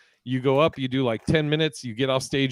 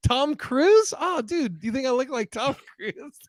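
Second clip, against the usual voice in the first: excited retold question; 10 semitones higher than usual. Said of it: "And he's like".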